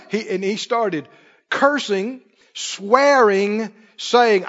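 normal talking voice

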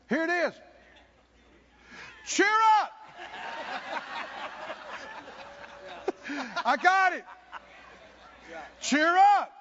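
Paul ringing out cheer up over the storm; shouted cheer up. Acted. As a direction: call it out to lift the crew. Paul shouting his message.

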